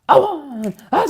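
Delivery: hugely energetic count-in, high and loud; bandleader's exuberant count, imitated. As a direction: count the band in with explosive energy. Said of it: "does the most energetic count".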